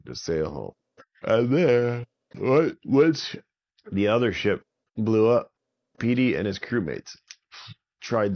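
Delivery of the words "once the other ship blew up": ordinary delivery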